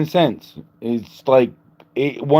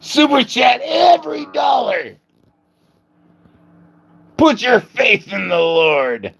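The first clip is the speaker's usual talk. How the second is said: mock preacher shout; 12 semitones higher than usual. Mock televangelist plea.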